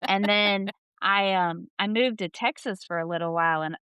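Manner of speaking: usual speaking voice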